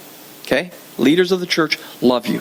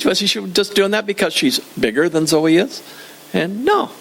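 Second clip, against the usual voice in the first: usual speaking voice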